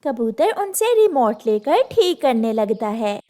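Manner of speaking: usual speaking voice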